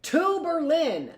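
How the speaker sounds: high, slow mock rally cry; retold shout. Paris crowd urging war, a high drawn-out cry. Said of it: "people were shouting".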